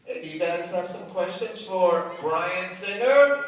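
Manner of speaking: loud, drawn-out host call rising in pitch; rally the crowd, stretching the words out; big emcee shout-out to the room; calling to the audience. Real, not acted.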